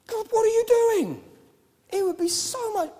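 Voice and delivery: high and loud, trailing into a whisper; mock frustrated plea to God; hammed-up complaining; exasperated complaint. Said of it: "it's kinda like".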